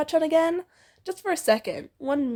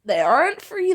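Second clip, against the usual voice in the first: usual speaking voice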